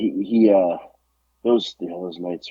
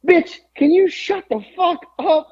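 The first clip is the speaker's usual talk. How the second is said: high, slow caricature snarl at a chatty woman; mock New Jack; 5 dB louder than usual. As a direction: ham up the tough guy's rude outburst, pitched way up. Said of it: "And New Jack says".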